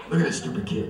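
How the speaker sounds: very low mock mother voice; deep mom voice. Comic repeats his mother's line in a deep voice. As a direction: drop way down and sneer. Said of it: "She got a really deep voice".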